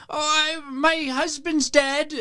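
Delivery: put-on high, slow frail old lady; very old woman. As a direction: speak like a grieving old lady. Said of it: "a very old woman".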